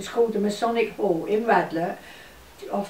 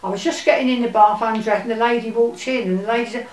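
ordinary talk, nothing marked

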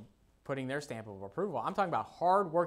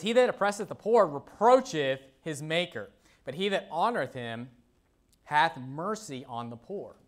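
measured, louder Bible reading that slows at the end; scripture reading; 2 semitones higher, 4 dB louder than usual. Acted. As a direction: read it out as holy words. Reads scripture aloud.

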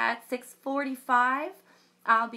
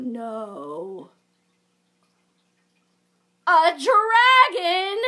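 lively storybook read-aloud with big reactions; reading to children; 2 semitones higher, 6 dB louder than usual. A narrator's reading. Librarian reading a unicorn story to children, animated. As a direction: read it like a picture book for kids.

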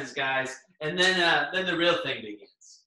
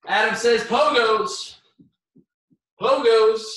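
loud, slow, high call-out; game-caller voice; 6 semitones higher, 6 dB louder, slower than usual. Shown as a demonstration. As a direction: announce it like a game host. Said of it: "I should just always talk like that".

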